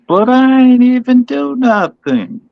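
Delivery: put-on high, slow whiny kid; whiny tattling little sibling. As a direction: whine like a blamed child. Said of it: "Be like the kids from The Thirst".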